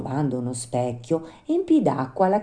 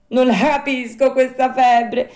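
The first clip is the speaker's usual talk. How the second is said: high, loud puppet voice, full of worry; ailing Pinocchio; 11 semitones higher, 9 dB louder than usual. Acted. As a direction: complain like a sick, frightened puppet.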